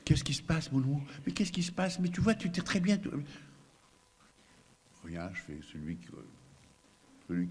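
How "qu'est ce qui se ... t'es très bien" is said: higher, louder anxious mother; fretful mother, fast and high-pitched; alarmed mother. Retold or imitated. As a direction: rush in fussing over the crying baby. Worried mother rushing back in.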